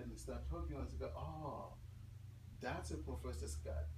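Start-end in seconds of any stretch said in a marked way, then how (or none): none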